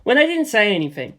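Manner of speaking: usual speaking voice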